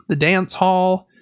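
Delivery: ordinary talk, nothing marked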